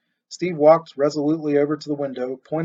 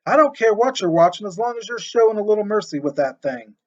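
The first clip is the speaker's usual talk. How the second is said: louder, higher shouted complaint; shouting across yards; 6 semitones higher, 6 dB louder than usual. A narrator's reading. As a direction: shout at the neighbor.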